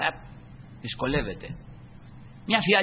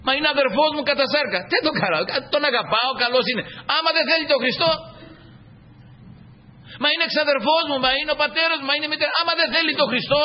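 higher pleading voice of a doubter; lightly voiced objector, pitched up; objecting believer; 8 semitones higher than usual. Preacher imitating a believer defending his kin, pitched up.

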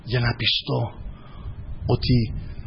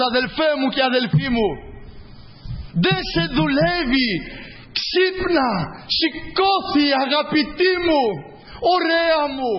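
raised, driving preacher's voice with rising calls; fervent preaching; 9 semitones higher than usual. Acted. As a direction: preach it at full force to the congregation. (ένθερμο κήρυγμα με δυνατή φωνή)

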